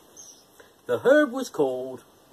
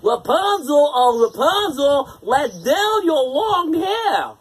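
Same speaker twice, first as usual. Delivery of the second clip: louder, slow imitation of the witch's call; prince calling up; 8 semitones higher, 6 dB louder, slower than usual. A narrator's reading. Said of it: "he called".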